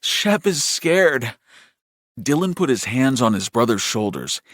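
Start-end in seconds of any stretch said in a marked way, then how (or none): 0.0-1.3 high, slow, drawn-out words; mounting fear in a raised voice; scared, shaking. A narrator's reading. Shaking brother telling his brother, high and frightened. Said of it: "beginning to shake more violently".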